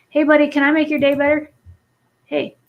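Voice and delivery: chirpy sim voice. Pretend. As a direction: pipe it up like a cheerful sim offering help. Voicing the sim, cutesy.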